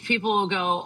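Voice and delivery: ordinary talk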